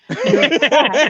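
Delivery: loud, high, laughing "You're fat"; laughing punchline. Retold or imitated. Newhart's blunt verdict, loud and laughing. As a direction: blurt it out through a laugh.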